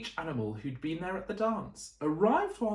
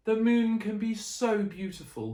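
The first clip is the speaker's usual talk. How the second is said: ordinary delivery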